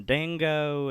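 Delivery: exaggerated Australian twang on "dango"; mock Australian accent. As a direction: imitate a bad Australian accent. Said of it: "had to do Australian accents".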